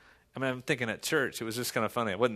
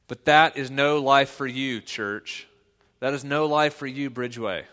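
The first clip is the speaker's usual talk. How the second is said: louder, rising preacher's call; full-force preaching; 6 dB louder than usual. Real, not acted. Pastor exhorts the Bridgeway church, loud preaching. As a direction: build to a ringing exhortation.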